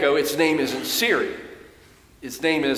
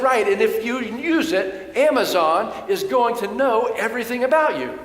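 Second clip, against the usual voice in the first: agitated son; 6 semitones higher than usual. Retold or imitated. Preacher voicing his son warning about Amazon, higher insistent tone. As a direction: press the warning home. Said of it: "He said".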